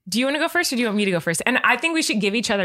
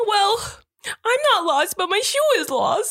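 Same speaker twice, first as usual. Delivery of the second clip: high kid voice with a mock whimper; a four-year-old half-crying about a shoe; whiny lost child; 11 semitones higher than usual. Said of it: "do a baby voice".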